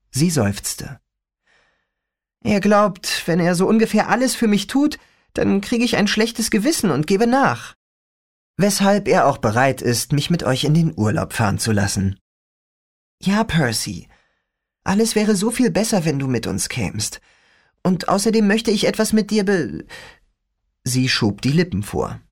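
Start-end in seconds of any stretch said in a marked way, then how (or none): 2.4-12.2 put-on lightly higher girl's voice, disgusted; a young girl griping about her father; teen girl voice. Rachel continues. 13.2-19.9 put-on lightly higher girl's voice, disgusted; a young girl griping about her father; teen girl voice. Rachel pleading.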